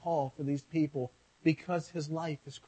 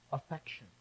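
ordinary talk, nothing marked

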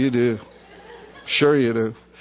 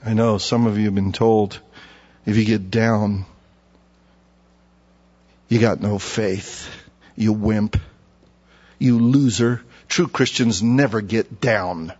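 mock critics' scorn; 8 semitones lower, slower than usual. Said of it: "quoting the harsh critics".

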